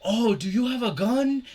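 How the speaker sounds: high, loud, imitating a Japanese local; mock polite question in a high voice; Japanese accent. Imitating Japanese person asking.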